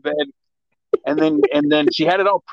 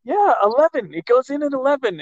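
higher, clipped annoyed repeat; annoyed insistence; 8 semitones higher than usual. Retold or imitated. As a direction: insist it goes in at eleven. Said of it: "She's like".

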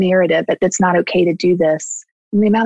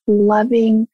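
ordinary delivery

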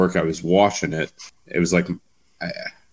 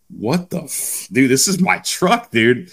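outraged; 6 semitones higher, slower than usual. Retold or imitated. A young man whose truck was just punched, indignant outburst. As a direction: explode at the guy who hit your truck.

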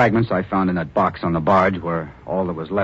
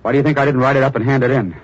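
angry protest; 7 semitones higher, 5 dB louder than usual. Acted. Reporter protests to editor.

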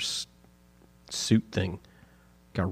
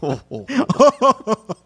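loud, slow, laughing jolly menace; big drawn-out belly laugh and taunt; Santa; 9 semitones higher, 8 dB louder, much slower than usual. Acted. Co-host acts out tickling on days off, jolly menacing laugh. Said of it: "This is what Santa does".

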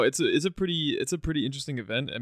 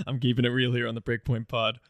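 ordinary talk

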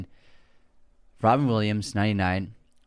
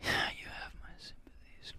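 breathy whisper to himself; 16 dB quieter, slower than usual. Real, not acted. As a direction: mutter it under your breath. Repeating quote under breath.

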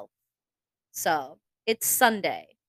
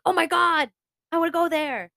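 excited; 10 semitones higher, 6 dB louder than usual. Retold or imitated. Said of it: "Andrew was like".

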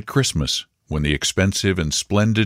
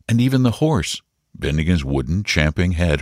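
usual speaking voice

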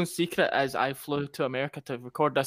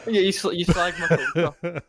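laughing through the words; slow laughing tease; 3 semitones higher, 5 dB louder, slower than usual. Real, not acted. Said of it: "laughing banter".

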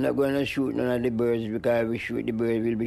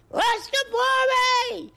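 slow falsetto crowing of Rastafari; rooster crow; 3 dB louder, slower than usual. Pretend. Crowing like a rooster for the interviewer, slow falsetto. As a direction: crow it out high and stretched like a rooster. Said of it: "says the cock".

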